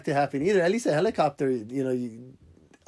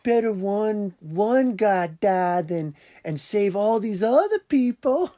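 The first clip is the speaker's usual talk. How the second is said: put-on southern accent; 6 semitones higher, slower than usual. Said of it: "that governor southern accent".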